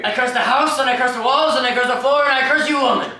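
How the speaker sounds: loud, high, escalating curses; building curse rant. Acted. Irish father cursing his house and wife, loud and high.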